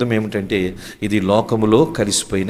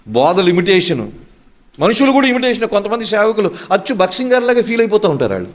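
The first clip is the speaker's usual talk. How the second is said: raised-pitch pulpit denunciation; fervent preaching; 6 semitones higher than usual. Real, not acted. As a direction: list each example insistently, pitched up. Preacher denounces imitation to congregation.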